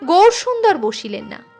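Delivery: plain reading voice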